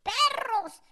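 high, stretched-out mock cursing; mock cursing mother. Mother complaining about dogs, high mock cursing. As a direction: drag out the censored insult up high. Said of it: "Y también dijo".

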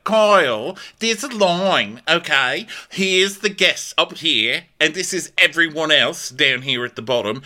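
high lecturing mock voice; prissy high-pitched scolding impression; mocking Natalia. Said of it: "Natalia's like".

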